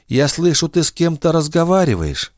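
put-on high old woman's voice; old grandmother. A narrator's reading. Grandmother wakes and hears voices, high old voice.

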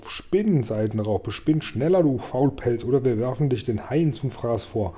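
usual speaking voice

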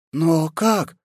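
high, puzzled questions; bewildered. A narrator's reading. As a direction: ask in baffled disbelief. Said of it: "недоумевала Андросова".

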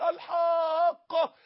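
long, high, loud wail of الحاقه; drawn-out cry. Shown as a demonstration. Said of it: "كأن واحد بيصرخ".

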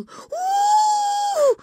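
long, very high wail of pain; pained howl. A narrator's reading. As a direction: howl over a hurt nose. Hurt girl falls holding nose.

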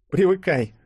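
high, loud wry character voice; scoffing. A narrator's reading. Yulia telling the hero to get used to it, wry scoff. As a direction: toss it off with a high-pitched scoff. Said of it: "хмыкнула Юля".

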